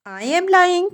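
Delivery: sharp high-pitched disbelieving echo; incredulous echo. A narrator's reading. Porphyry echoing Raskolnikov's charge, high incredulous disbelief. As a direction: toss the accusation back in mock outrage. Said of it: "Porphyry repeated, apparently incensed".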